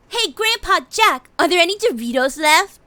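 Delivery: put-on high cute teasing girl; playful gamer girl voice. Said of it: "She said".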